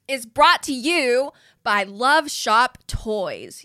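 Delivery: big, slow, drawn-out announcer delivery; sponsor announcement. Pretend. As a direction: present the sponsor with a flourish, stretching it out.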